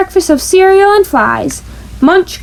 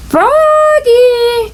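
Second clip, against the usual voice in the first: high, drawn-out teacher's call; 9 semitones higher than usual. A narrator's reading. Miss Witherspoon calls Froggy across the pumpkin patch. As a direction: call him back like a patient teacher. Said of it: "called Miss Witherspoon".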